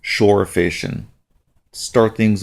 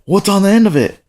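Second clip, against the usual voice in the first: high, quick, eager question; retold boyish excitement; excited child's question; 10 semitones higher, 4 dB louder, faster than usual. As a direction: ask like a kid with a first bite. Son asks about the catch.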